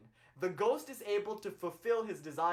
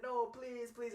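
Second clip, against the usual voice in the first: higher, imitated begging; mock tearful pleading; 6 semitones higher, 3 dB quieter, slower than usual. Man begs the yogi for a ghost, mock tearful pleading. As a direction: plead as if weeping, lightly acted. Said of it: "he's crying and he's weeping".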